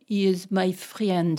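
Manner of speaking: slow, with "friend" split into free-END; learner's mispronunciation. Retold or imitated. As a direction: imitate a student saying "friend" as "free-end". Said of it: "new student and they say to you".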